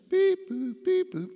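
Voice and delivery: high-pitched two-note beeping; imitated alarm beeps. Patient lying there listening for alarms, imitated beeping. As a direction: mimic a monitor alarm, pitched far up.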